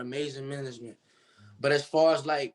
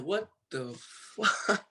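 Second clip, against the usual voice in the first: higher, drawn-out slow disbelief; stunned disbelief; 5 semitones higher, much slower than usual. Retold or imitated. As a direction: stretch it out, stunned by the news. Waking to viral Instagram.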